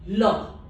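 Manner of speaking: L said as "luh"; French le. Shown as a demonstration. Teacher reading the masculine article, L as "luh". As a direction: say L with the vowel of her. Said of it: "so it is a".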